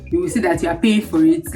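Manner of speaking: ordinary delivery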